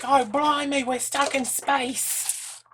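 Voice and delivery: high, loud, hammed-up Australian wail; mock Australian accent. Imitating a crazy-acting actress stuck in space, hammed-up Australian accent. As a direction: exaggerate an Australian accent, stretching the last words. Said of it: "that crocodile accent they get".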